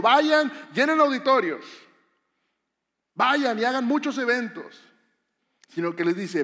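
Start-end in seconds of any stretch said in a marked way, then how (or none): 0.0-4.8 mock proclamation. Mock quote of wrong command.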